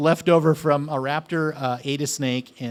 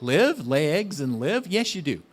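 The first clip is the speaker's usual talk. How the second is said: ordinary talk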